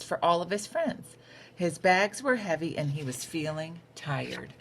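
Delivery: bright, lively storybook read-aloud; a teacher reading a picture book to her class; reading to children. A narrator's reading. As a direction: read it like story time for little kids.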